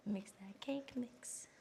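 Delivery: slow whispered chant; whispering. Acted.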